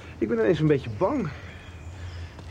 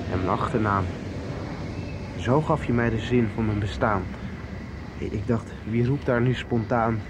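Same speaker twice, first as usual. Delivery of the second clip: reciting a poem; 6 semitones lower than usual. Acted. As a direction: recite the lyrics tenderly, line by line. Recites his own lyrics.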